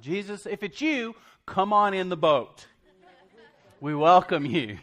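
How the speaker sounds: high, loud, cheerful calling out; eager invitation. Pretend. Preacher pretends to call Jesus into the boat, loud and cheery. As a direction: call him into the boat, delighted. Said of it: "I'm like".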